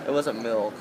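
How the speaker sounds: ordinary talk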